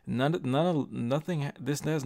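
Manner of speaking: passionate, tripping over the first words; stuttering. Real, not acted. Speaker insisting the shooting is not about race, stuttering with passion. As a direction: stumble and restart, worked up. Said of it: "I'm sorry if I'm stuttering".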